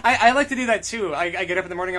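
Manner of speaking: ordinary talk, nothing marked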